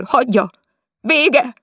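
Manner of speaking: high, loud despairing cry. Acted. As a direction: shout it out in anguish. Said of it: "kiáltott". (kétségbeesett kiáltás)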